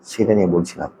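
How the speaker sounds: ordinary talk, nothing marked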